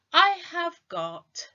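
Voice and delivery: high slow "I have got"; demo answer. Shown as a demonstration. Teacher models answering what she carries. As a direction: model the reply brightly. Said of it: "I would say".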